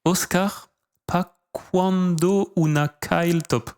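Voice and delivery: careful learner's Spanish: "¿pa' cuándo una Kyle top?"; reading foreign language. Shown as a demonstration. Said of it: "I can try to read it in, in Spanish".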